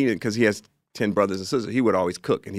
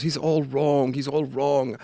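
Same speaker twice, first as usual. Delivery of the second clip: higher mock yell, building to the end; mild retold scream; 7 semitones higher than usual. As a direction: imitate the director ranting behind the door. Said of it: "And he's just screaming".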